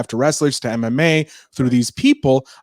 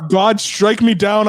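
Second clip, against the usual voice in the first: exclamation; 8 semitones higher, 4 dB louder, slower than usual. Real, not acted. Wishing for wrestling stunt.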